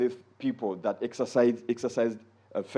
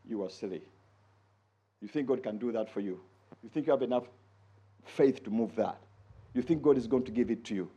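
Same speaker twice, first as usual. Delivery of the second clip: scornful inner voice; 4 semitones lower, 6 dB quieter than usual. Pretend. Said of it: "then you sit back and say".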